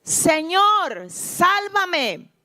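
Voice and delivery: high, falling plea, little added volume; grito de auxilio narrado. A narrator's reading. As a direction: cry out for rescue as a storyteller would. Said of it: "y gritó". (grito de auxilio leído, agudo y suplicante)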